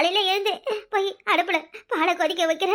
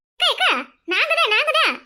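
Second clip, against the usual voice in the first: high, loud urgent call; alarmed calling out; 6 semitones higher, 5 dB louder than usual. Acted. Neighbour announcing herself to a panicked friend, urgent and high.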